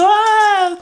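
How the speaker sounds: sudden high, loud "ah" burst; agitated outburst. Shown as a demonstration. Showing viewers how she doesn't sound in German, a sharp high yelp. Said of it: "Also ich spreche nicht so".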